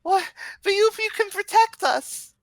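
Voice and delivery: put-on very high little-kid voice; pleading child. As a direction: plead in a squeaky child's pitch. Game master voices the pleading kids.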